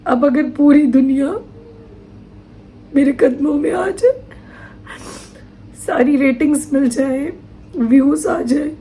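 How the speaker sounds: choked with grief, long pauses between phrases; real tearful breakdown. Real, not acted. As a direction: push the words out through tears, stopping often. Breaking down over her loss.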